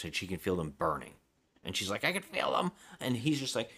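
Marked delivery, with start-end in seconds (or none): 2.0-2.7 higher, mock-panicked quote; play-acted panic, pitched up; retold freak-out. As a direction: imitate her freaking out in a raised pitch. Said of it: "she starts freaking out".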